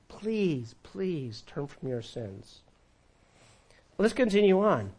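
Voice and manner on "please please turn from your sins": slow, much softer plea; begging. Acted. Believer begging a sinner to turn, slow soft plea. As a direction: beg quietly, drawing it out. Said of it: "Just like how you would beg God".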